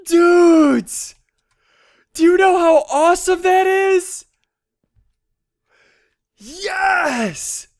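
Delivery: loud, high thrilled yelling; ecstatic shout. Real, not acted. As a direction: shout the good news to your viewers. Thrilled at mining level up.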